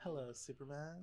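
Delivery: slow, hushed villain greeting; soft, menacing villain voice; Lex Luthor impression. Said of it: "John Michael Vige's Lex Luthor".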